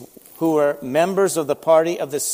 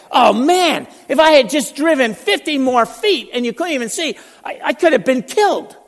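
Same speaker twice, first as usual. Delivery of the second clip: raised, louder voice of a man startled to have nearly died; shocked relief; 7 semitones higher, 4 dB louder than usual. Retold or imitated. Driver realising he nearly drove off a cliff: shaken exclamation. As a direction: gasp it out as if you just saw the cliff edge.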